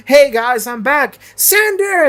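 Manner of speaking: high, cheesy sitcom greeting; sitcom entrance. Retold or imitated. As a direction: burst in like a sitcom character. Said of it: "walks in like a fucking sitcom".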